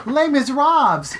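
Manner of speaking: put-on high, girly voice for Les Miserables; man doing a woman's voice for the title; pretty girl voice. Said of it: "this isn't gonna work".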